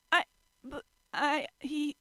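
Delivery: high, broken sputter. A narrator's reading. Toru sputtering at Shigure, high flustered voice. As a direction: trip over the words. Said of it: "she sputtered".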